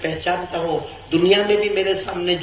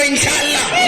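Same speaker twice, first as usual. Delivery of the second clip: high, ringing crowd-rousing cry; louder call; 12 semitones higher, 6 dB louder than usual. Shown as a demonstration. Preacher making the crowd say it with more voice, high rallying cry. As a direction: call it out high for everyone to follow. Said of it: "ابھی بھی اور آواز سے بولو".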